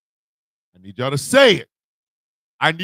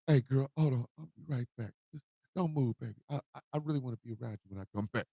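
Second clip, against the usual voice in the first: soft, much softer smooth-talker; hushed fast pickup line; smooth preacher man voice; 4 semitones lower, 11 dB quieter, much faster than usual. Retold or imitated. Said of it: "smooth, deep, uh, preacher man voice".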